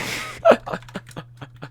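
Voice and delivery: breathless high-pitched laugh; high wheezy laugh. Real, not acted. Laughing after quoting insult.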